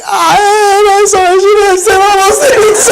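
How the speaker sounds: high, loud, tearful cry of sorry; choked-up, overwhelmed apology. Retold or imitated. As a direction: blurt it out through tears. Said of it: "we both started crying".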